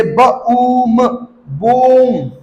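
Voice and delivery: ब, ऊम apart, then long बूम; sounded out slowly. Shown as a demonstration. Teacher showing long ऊ, slowly blended. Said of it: "ऊ का sound".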